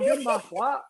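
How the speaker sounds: amused retort. Retold or imitated. Retort retold again.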